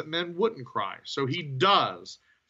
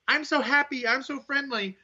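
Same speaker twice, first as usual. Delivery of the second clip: much higher, sugary mock-cheerful voice; cheery Bayley; 10 semitones higher than usual. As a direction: parody a bubbly, overly friendly wrestler. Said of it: "she's already the".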